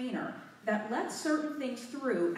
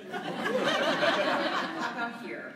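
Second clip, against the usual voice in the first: nervous laughter, out of breath; breathless, giggly nerves into the mic; 4 semitones higher, slower than usual. Real, not acted. Speaker nervously laughing into the mic to the audience. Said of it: "I'm just out of breath. I'm nervous".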